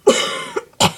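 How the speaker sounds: high raspy wheezing cough; hacking cough of a wheezer; wheezy cough. Shown as a demonstration. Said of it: "Wheezy is more of a high-pitched voice".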